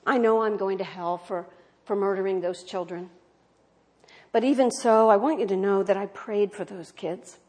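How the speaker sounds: ordinary talk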